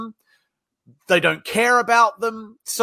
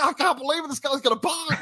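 higher, lightly excited imitation of the caller; mock thrilled salesman; 7 semitones higher than usual. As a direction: play the caller who can't believe his luck. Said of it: "He was, he was thrilled".

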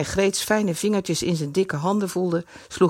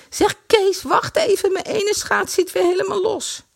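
put-on girlish call; 14 semitones higher than usual. A narrator's reading. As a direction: call after him to wait. Girl calling from behind.

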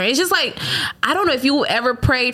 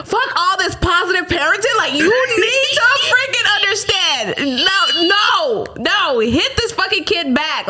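slow, high, emphatic scolding; frustrated mom pleading; mock exasperated lecture; 8 semitones higher than usual. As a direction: drill it in, slowly and high. Upset mom lecturing daughter.

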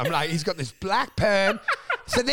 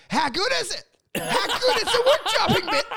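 much higher, gleeful mock enthusiasm through laughter; mock hype; 12 semitones higher than usual. Comic hyping a bad joke to pub strangers, mock glee. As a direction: oversell the joke with fake glee. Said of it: "we'd just go".

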